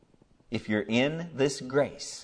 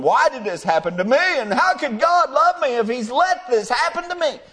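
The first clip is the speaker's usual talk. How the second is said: much higher, louder whining plea; mock lament of the lost; 13 semitones higher, 8 dB louder than usual. Preacher voicing lost people complaining to God, high and loud. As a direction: cry out like someone railing at God. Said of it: "that's the way they talk".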